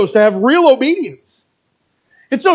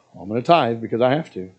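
much lower, softer, dragging reluctance; reluctant tither; 12 semitones lower, 6 dB quieter than usual. Retold or imitated. Reluctant church member grumbling about the tithe, low and sullen.

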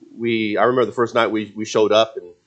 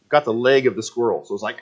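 ordinary talk, nothing marked

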